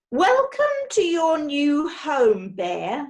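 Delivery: slow, grand villain welcome; villainous woman voice. A narrator's reading. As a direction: roll it out like a gracious villain.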